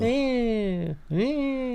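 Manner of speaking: grossed out, rising; mock disgusted ew. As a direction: pull a face and say ew. Echoing disgust.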